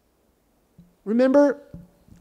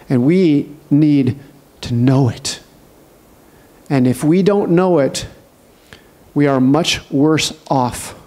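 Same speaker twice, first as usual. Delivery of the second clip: solemn preaching; 8 semitones lower than usual. Real, not acted. Preacher warning the church that judgment is real, solemn. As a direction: let each word land like a verdict.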